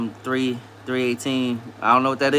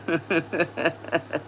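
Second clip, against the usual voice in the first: real, softer rolling laugh; 5 semitones higher, 6 dB quieter than usual. Real, not acted. Streamer teased as Jimmy Neutron, laughing. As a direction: crack up in a run of chuckles.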